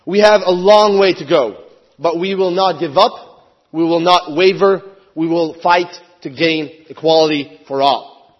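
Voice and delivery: ordinary delivery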